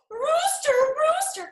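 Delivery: high, loud urgent call. A narrator's reading. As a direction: shout the name across the yard. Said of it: "called Hen".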